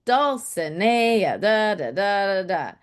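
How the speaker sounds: Dulcinea then da, da, da, da, da tapping out the beat; rhythmic da-da chant of the meter; da-da stress rhythm. Shown as a demonstration. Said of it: "I was saying it with the stresses".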